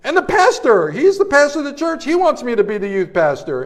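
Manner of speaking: dramatised desperate appeal; pleading prayer. Retold or imitated. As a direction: act out protesting to God, pitch climbing. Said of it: "I'm praying. I'm looking up going".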